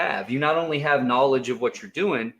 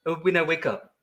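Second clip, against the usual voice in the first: imitating a friend; 6 semitones higher than usual. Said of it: "He's like".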